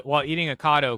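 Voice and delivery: ordinary delivery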